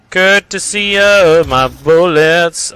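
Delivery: slow, stretched "Courtesy of my bullets"; drawn-out taunt. Pretend. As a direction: drag out each word of "Courtesy of my bullets" mockingly. Repeats taunt drawn out for effect.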